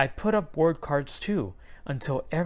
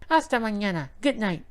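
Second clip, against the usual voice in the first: parrot voice; 8 semitones higher than usual. A narrator's reading. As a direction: squawk goodnight like a parrot. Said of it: "Mango calls".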